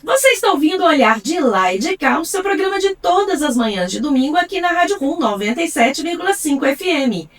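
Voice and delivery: scripted programme promo, deliberately phrased; radio announcer. Acted. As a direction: present the show like a radio host. Host reads the programme announcement.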